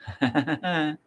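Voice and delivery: high chuckle; short run of high laughter. Real, not acted. Comedian amused by own threat to let you know, high laugh.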